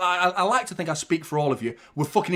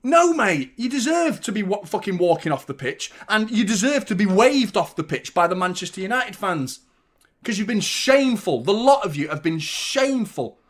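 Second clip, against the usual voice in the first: loud, higher angry yelling; furious shout; 5 semitones higher, 5 dB louder than usual. Real, not acted. Fan yelling at the team after the derby, furious. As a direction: roar the shame at them.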